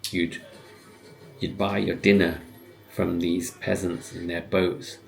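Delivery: usual speaking voice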